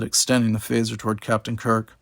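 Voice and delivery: ordinary delivery, nothing marked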